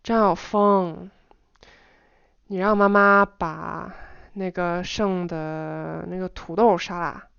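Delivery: slow reading with lengthened syllables; slow, drawn-out instruction; varied intonation, drawn out. Shown as a demonstration. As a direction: stretch the words out, pausing between phrases. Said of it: "vary my intonation in general".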